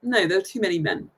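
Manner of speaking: usual speaking voice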